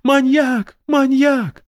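high kid playing at fright; mock-scared child. A narrator's reading. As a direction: squeal like a kid in a game. Girl urges flight.

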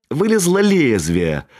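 plain reading voice

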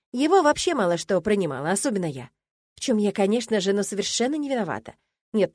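usual speaking voice

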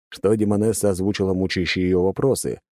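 ordinary delivery

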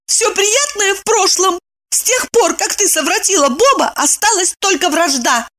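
high-pitched cold cut-off; cutting reply. A narrator's reading. Evdokia declares the friendship over, cutting and high. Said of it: "Евдокия отрезала".

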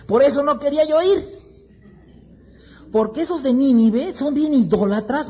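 an indignant prophet whining at God; angry complaint. Acted. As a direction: Jonah angrily complaining to God. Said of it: "Jonás se enoja con Dios y le dice".

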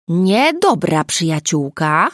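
a hint of a threatening scold; mock menacing grumble. Nina grumbling at Agata in mock menace, slow. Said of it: "burknęła Nina groźnym tonem".

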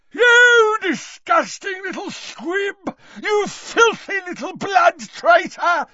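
very high, slow screaming fury; enraged roar. A narrator's reading. Gaunt attacks his daughter, enraged roar. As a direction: roar it shrieking, out of control. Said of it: "roared Gaunt, losing control".

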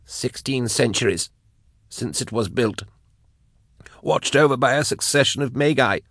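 high, soft, reluctant answer; shivering captive's small high voice; tight and unwilling. A narrator's reading. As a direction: let the word out against your will. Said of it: "every word tight and unwilling".

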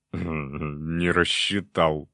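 slow, sly tipsy demon voice; gloating drunk demon. Acted. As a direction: drag it out, pleased with the mischief. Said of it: "злорадно хихикнул подвыпивший демон". (злорадный пьяный демон)

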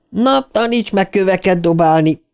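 high-pitched challenging cry; shouted taunt. A narrator's reading. Richie throws a stone at Viktor, shouted taunt. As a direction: call it out at the enemy as a dare. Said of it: "kiáltotta Ricsi".

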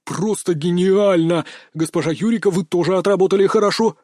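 lightly voiced gushing director; approving director. A narrator's reading. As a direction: hand out praise, drawing out the first words. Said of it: "благосклонно кивнул он смутившейся женщине".

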